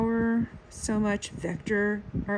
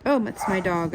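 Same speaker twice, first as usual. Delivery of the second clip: usual speaking voice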